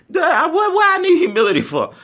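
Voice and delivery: high, loud laughing sass; sassy duh. Real, not acted. Storyteller mocking the humility lesson, loud high sass. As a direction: toss it off with diva attitude.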